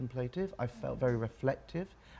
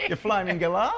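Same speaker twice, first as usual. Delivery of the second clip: louder, higher mock-Australian jibe; playful Aussie slang; 5 semitones higher, 6 dB louder than usual. Shown as a demonstration. New citizen showing off slang, mock-Aussie accent. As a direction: throw out a hammed-up Aussie insult, amused. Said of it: "What's your favourite Aussie slang?".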